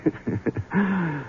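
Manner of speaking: put-on high, soft drunken chuckle; bitter drunk laugh. Drunk husband laughing at his luck, soft.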